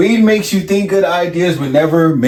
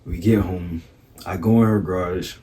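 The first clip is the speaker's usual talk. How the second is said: normal talking voice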